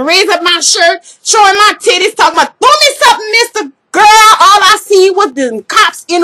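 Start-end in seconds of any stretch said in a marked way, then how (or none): 2.6-3.7 high-pitched tipsy holler, retold; calling out. Tipsy reveler retold begging a parade rider for throws, high calling out. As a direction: call it up high to a stranger.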